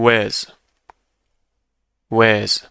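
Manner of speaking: was as a short unstressed wəz; repeat-after-me wəz; weak form of was. Shown as a demonstration. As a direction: say was with a schwa. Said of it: "بعد از من بگین".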